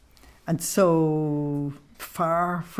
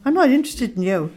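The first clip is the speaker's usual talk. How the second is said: blunt dismissal; 6 semitones higher, 4 dB louder, faster than usual. Retold or imitated. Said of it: "she told the angel plainly".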